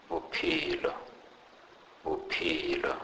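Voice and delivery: "bophelo" with an aspirated P; example word demo. Shown as a demonstration. Teacher gives a P H example, puffed P in "bophelo". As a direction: say "bophelo" carefully, P as in "peach". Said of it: "pronounced like the P in the word peach".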